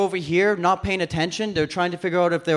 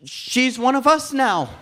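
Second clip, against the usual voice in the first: higher-pitched, upbeat mock reassurance; reassuring; 6 semitones higher than usual. Calming panicked buddies, breezy mock reassurance. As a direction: settle them down with misplaced confidence. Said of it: "I didn't want them to panic".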